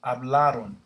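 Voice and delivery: Hablaron, stress on the middle a; Spanish conjugation. Shown as a demonstration. Teacher modelling the plural form for students. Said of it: "A R O N".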